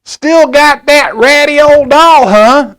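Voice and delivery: put-on high, loud, slow sneering kid voice; mocking kid. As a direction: taunt it like a snide older kid. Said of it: "And she's like".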